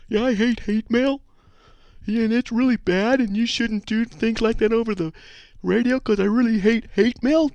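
high falsetto whiny listener; mock a complaining emailer in a squeaky voice; high put-on whiny caller. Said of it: "then I can imitate you".

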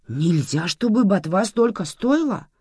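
sharply. Acted. Aunt Manana scolding about the price of greens, sharply. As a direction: cut the words short and hard. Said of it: "сказала резко".

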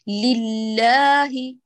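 لله drawn out very slowly, lifted in pitch. Shown as a demonstration. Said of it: "ترفعي طبقة الصوت".

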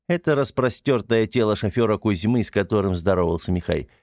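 usual speaking voice

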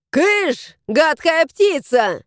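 high shrieking shoo, slow on гадкая птица; shout. Acted. Nanny yells at the stork to shoo it away. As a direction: chase the bird off. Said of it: "кричала она".

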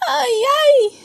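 high-pitched wailing sob; hammed-up crying cry of distress; inconsolable acted crying. A narrator's reading. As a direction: wail like a heartbroken story character. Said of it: "llora desconsolado".

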